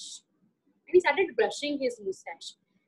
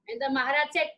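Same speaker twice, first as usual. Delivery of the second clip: ordinary delivery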